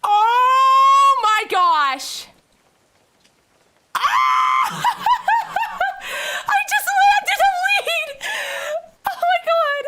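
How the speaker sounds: very high, loud squeals with laughter; real ecstatic shouting, near tears; overjoyed screaming. Real, not acted. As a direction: shriek with joy at landing the part. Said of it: "I'm so happy about this".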